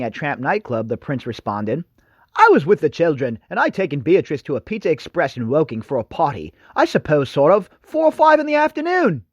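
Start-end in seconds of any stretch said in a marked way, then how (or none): 2.3-9.2 badly faked English accent of a buffoonish royal; terrible English accent. Host reading the prince's alibi in a mock English accent. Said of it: "in my terrible English accent".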